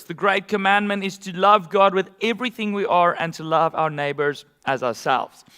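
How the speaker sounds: usual speaking voice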